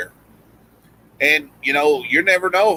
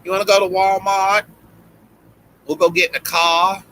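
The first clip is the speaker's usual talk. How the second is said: put-on Boston accent, higher, with a country twang; 6 semitones higher than usual. Doing Boston friend Vicky for the podcast hosts, twangy Boston accent. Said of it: "And she'd be like".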